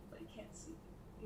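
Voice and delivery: hushed secret whisper. Real, not acted. Secret about hidden flag.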